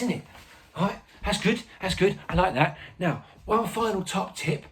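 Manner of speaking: chatty informal pupil-like presenter; Ernie. Acted. Teacher plays Ernie, a helper.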